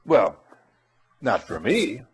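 ordinary talk, nothing marked

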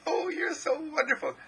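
very high, gushing admiration; adoring exclamation. Acted. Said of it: "you look into people's eyes and you say".

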